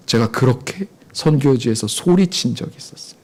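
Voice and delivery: ordinary talk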